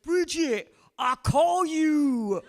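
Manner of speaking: high, loud shout with drawn-out words; imitated calling out. Fireman to her from the truck, imitated loud holler.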